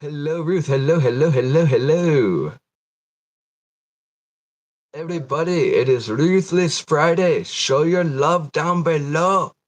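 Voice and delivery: high, bright shout-out to the chat; excited host greeting. Real, not acted. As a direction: hype up Ruthless Friday.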